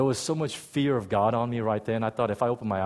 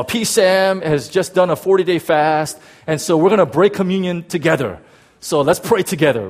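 loud, raised announcer-like pastor; announcing to the church; 5 semitones higher, 7 dB louder than usual. Retold or imitated. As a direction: imitate a pastor addressing the whole congregation.

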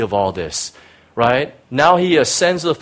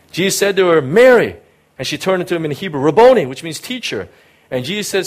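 raised, lively reading of the Gospel passage; a preacher reading scripture aloud; 3 semitones higher, 3 dB louder, faster than usual. Acted. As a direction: read it out to the congregation with feeling. Reads John 20 aloud.

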